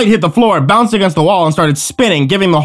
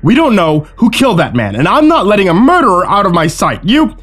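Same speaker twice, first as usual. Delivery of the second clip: high, forceful declaration; agitated commanding; 5 semitones higher, 3 dB louder than usual. Acted. Owen holds the group at gunpoint, agitated commanding. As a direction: lay down the law, agitated. Gunman orders the group around.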